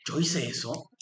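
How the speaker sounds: soft, startled "¿Yo hice eso?"; surprised dismay. Retold or imitated. Teacher voicing someone shocked at their own outburst, softly surprised. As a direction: caught off guard by your own outburst.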